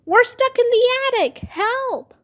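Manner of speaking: high, loud cry for rescue; screeching. A narrator's reading. Girl stuck in the attic to babysitter, screeching. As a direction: screech for help from a trapped spot. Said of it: "took a deep breath and screeched".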